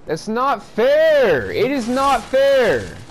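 high, slow, loud exasperated wail; whiny outrage. Real, not acted. Gamer protesting the enemy's dodge, high slow whining wail. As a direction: stretch every word in a high, loud wail of unfairness.

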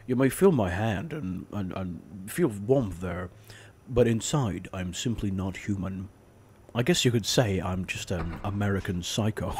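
low, soft, measured film narration; American Psycho monologue. Retold or imitated. Imitating the face mask scene, low quiet monologue. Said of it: "the face mask scene".

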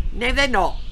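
high, loud squawk; squawking aunt. A narrator's reading. Fat aunt contradicting grandma, squawking. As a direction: squawk back at your sister. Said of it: "she squawked".

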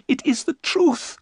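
high, trembling Mina; frightened young wife. Acted. Mina telling the men of her terror, high-voiced.

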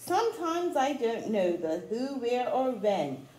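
rhythmic read-aloud of a rhyming picture book; storybook reading. Acted. Teacher reading a rhyming book to children, read-aloud style. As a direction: read the rhymes to children with a gentle lilt.

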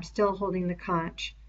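ordinary delivery

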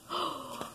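short, breathy gasp of wonder; amazed gasp. Real, not acted. Kid watching the cargo start fizzing, amazed gasp. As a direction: gasp at the sight.

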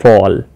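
"ફોલ" with an open "ફો" vowel; pronunciation demo. Shown as a demonstration. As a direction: say "ફોલ" low, with F-A sounded as "faw". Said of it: "F A નો ઉચ્ચાર ફો તરીકે થાય છે".

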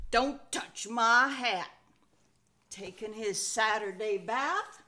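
folksy read-aloud with a cowboy twang; lively Old West narration for children; western storytelling. A narrator's reading. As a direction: spin it like a campfire tale out west.

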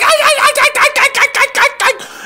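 very high yapping dog cries; dogs yelping. Pretend. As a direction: yelp like little dogs in the truck pickup. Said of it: "the dogs will be like".

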